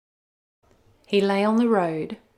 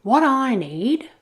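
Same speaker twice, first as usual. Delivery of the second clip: grumbling wolf; 6 semitones higher, 6 dB louder, slower than usual. Acted. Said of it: "grumbled Lou".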